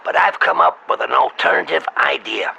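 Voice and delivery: ordinary delivery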